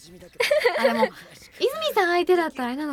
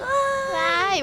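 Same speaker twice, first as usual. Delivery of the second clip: mocking cheer; 10 semitones higher, much slower than usual. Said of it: "わーいじゃない".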